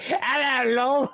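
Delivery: high, silly British greeting; mock British hello. Player mimics own earlier messing around, high mock British. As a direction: toss out a high-pitched joke British hello. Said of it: "I was messing around earlier".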